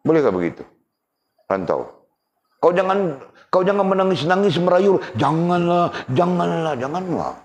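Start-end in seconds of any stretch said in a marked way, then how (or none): 2.6-5.0 high, loud scolding voice. Acted. Voiced quarrel, telling not to cry. 5.2-7.3 pleading. Acted. Pleading in quarrel.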